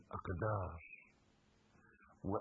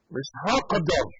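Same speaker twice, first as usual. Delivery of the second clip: مش هقدر in a Fellahi accent, louder; rural Egyptian accent; 5 semitones higher, 12 dB louder than usual. Shown as a demonstration. Preacher saying مش هقدر in a Delta peasant accent. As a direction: say مش هقدر like a farmer. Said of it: "بالفلاحي".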